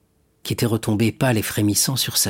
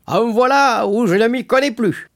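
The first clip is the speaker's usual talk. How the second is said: put-on loud, high-pitched drunkard; Caderousse, drunk; 13 semitones higher, 5 dB louder than usual. A narrator's reading. Caderousse baffled by Fernand's love, drunken voice. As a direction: crow it in a tipsy, wine-soaked voice. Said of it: "d'une voix de plus en plus avinée".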